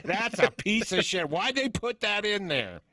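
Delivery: high-pitched mock indignation; filmmaker griping. Moviemaker pointing out a part they made, high mock outrage. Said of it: "it's like somebody making a fucking movie going".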